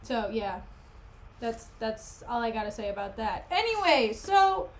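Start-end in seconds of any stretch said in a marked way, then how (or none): none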